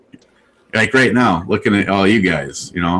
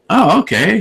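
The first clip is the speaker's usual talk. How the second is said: surprised; 12 semitones higher than usual. Retold or imitated. Reacting to whiskey bottles.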